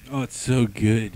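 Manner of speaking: ordinary talk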